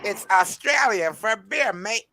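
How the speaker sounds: a tired Australian accent, voice not quite up to it. Shown as a demonstration. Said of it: "can you do that Australian accent again for me".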